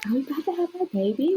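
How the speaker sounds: excited. Retold or imitated. Excited couple in story.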